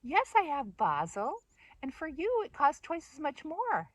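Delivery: high, singled-out "for you" with exaggerated politeness; playfully haughty reply; mock snootiness. Mocking reply to fussy customer.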